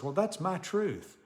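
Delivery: mocking quote. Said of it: "there's an expression going around".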